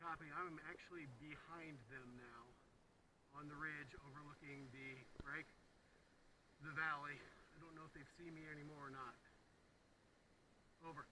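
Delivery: hushed report, hiding from enemy; whispering into radio. Real, not acted. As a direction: whisper so they won't hear.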